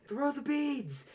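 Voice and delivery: high slow party holler; festive shout. Acted. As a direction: call it out like a Mardi Gras crowd. Mardi Gras cheer call.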